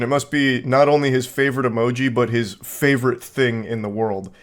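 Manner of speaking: ordinary delivery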